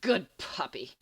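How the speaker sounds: breathless, wheezing mockery; wheezed taunt. Winded Snape taunting Sirius, wheezed mocking taunt. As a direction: wheeze it out, winded but smug. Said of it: "wheeze out a".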